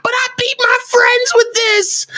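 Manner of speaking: very high, whiny mock kid voice; mock a crying kid bragging; high mock whine of a sore little cousin. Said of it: "they're crying, kicking and screaming".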